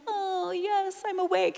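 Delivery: put-on high, sweet obedient child; compliant kid. Pastor voicing an obedient child waking up. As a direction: play the eager good child. Sleepy kid voiced in sermon.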